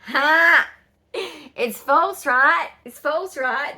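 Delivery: loud, high gloating "ha". Retold or imitated. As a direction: rub it in, high and loud, needling them. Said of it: "I was like".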